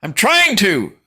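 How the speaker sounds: high, loud annoyed yell. Acted. Son yelling back at his mother, exasperated. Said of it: "I yell".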